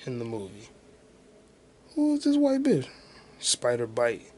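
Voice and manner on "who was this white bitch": high baffled yelp; exasperated squeal. Real, not acted. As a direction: squeak the question out. Frustrated searching.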